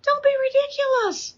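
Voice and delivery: high, scornful Don't be ridiculous; mock the boy in a high sneer; scoffing king. King Saul dismissing young David, high and scornful. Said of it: "so Saul says this, he goes".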